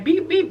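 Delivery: high mock beep-beep of a car horn; playful imitation of a horn. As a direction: toot it out like a little car horn. Imitating taxi horn in the story.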